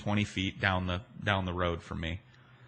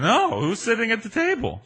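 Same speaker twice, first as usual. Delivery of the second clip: higher, puzzled retold question; clueless question; 12 semitones higher, 3 dB louder than usual. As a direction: ask it higher, truly clueless. His puzzled question to waitress.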